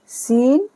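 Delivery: सीन said slowly and clearly, with an s sound; सीन, an Urdu letter name with a clear s. Shown as a demonstration. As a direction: spell out सीन with a crisp s. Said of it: "इस तरह पढ़ा जाएगा सीन".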